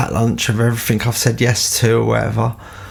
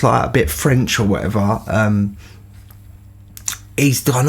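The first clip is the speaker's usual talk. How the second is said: ordinary delivery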